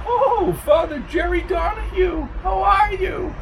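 very high falsetto woman's greeting; fluting, delighted character voice; gushing lady. Acted. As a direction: call out sweetly in falsetto. Flo waves and calls to priest.

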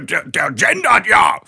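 hammed-up indignant shout; mock outraged commenter. Imitating an outraged podcast commenter, mock shout. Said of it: "kann man noch schreiben, so".

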